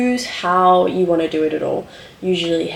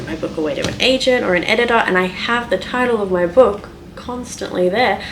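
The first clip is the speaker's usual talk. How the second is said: usual speaking voice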